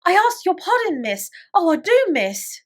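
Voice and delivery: high, loud begging bursts; frightened pleading. A narrator's reading. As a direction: plead like a scared servant girl. Terrified maid begs pardon.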